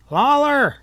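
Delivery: high, loud urgent shout; urgent call. A narrator's reading. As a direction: yell for the sheriff. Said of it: "he sounded urgent".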